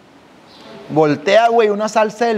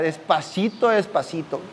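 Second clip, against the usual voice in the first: much softer, hushed; sneaking quietly; 2 semitones lower, 7 dB quieter, slower than usual. Retold or imitated. Man sneaking home at night, hushed. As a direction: tiptoe it under your breath.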